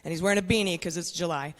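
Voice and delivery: ordinary talk, nothing marked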